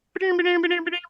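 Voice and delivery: high chirpy machine chime; ping it out like a little printer; sticker machine chime. Retold or imitated. Imitating the sticker printer for the listener, high chime. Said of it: "it makes this little sound".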